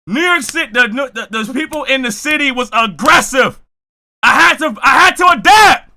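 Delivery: loud, high, emphatic testimony; fired-up. Real, not acted. Streamer recalling aggressive city people, fired-up. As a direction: build to a shout as you recount it. Heated account of city's aggression.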